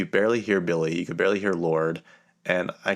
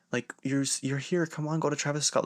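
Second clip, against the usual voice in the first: higher-pitched mock coaxing; imitated pleading; 7 semitones higher, 4 dB quieter than usual. Security guard urging fans to [name], mock pleading. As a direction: coax them along, lightly imitating someone eager. Guard urges them to the show.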